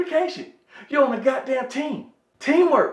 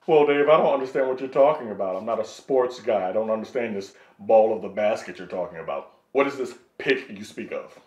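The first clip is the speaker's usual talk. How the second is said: much lower and softer, playing dumb; mock the teammate who pretends not to know basketball; a low, soft, dopey put-on voice; mock clueless teammate; 11 semitones lower, 6 dB quieter than usual.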